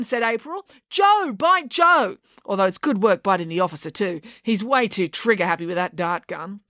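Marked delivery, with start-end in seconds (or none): 0.9-2.2 high, slow dog order; exasperated command. A narrator's reading. April redirects her dog to Joe, exasperated command. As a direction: call out a correction to the dog.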